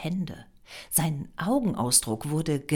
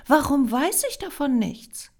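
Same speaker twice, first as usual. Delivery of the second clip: high, louder astonished question; pitched-up, affronted surprise; puzzled surprise; 10 semitones higher, 5 dB louder than usual. A narrator's reading. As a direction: demand an explanation, amazed. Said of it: "Greta hob verwundert das Kinn".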